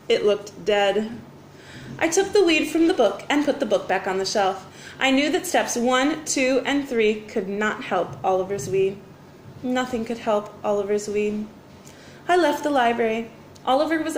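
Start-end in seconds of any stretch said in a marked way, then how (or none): none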